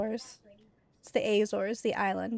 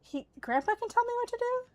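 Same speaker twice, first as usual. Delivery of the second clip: higher, fast, disbelieving question; incredulous question; 13 semitones higher, much faster than usual. Real, not acted. Parent to child, disbelieving the grandpa claim, higher and fast.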